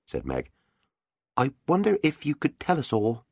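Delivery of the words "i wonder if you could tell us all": put-on polite girl. Meg speaks.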